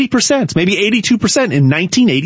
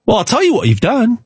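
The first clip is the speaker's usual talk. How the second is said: ordinary talk, nothing marked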